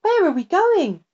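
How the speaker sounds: lightly voiced small girl, wondering; little girl. A narrator's reading. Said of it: "asked the little girl".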